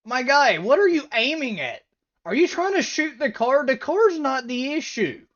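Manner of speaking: loud, high-pitched disbelief; exasperated heckling. Real, not acted. Viewer berates the officer for aiming at the car, exasperated. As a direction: call him out, voice pushed up.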